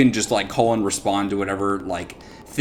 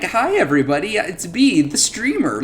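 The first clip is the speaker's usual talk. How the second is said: fake streamer greeting; 7 semitones higher than usual. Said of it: "super, like, fako".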